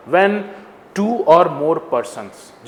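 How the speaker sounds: word by word, drawn right out; slow deliberate reading aloud. Shown as a demonstration. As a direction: read it slowly, like taking in every word. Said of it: "इस तरह से जो लिखा है ना".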